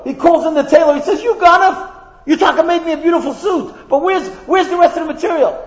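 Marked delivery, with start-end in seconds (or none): 1.2-5.6 higher, accusing king confronting the thief; angry king. Acted. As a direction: demand it like a furious king. King furious at the tailor.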